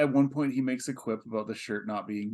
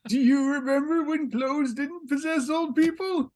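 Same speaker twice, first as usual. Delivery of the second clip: announcer voice; 6 dB louder than usual. Acted. Pepperidge Farm meme voice.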